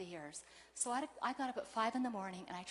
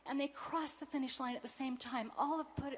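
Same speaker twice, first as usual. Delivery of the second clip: ordinary delivery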